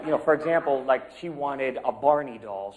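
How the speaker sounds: ordinary talk, nothing marked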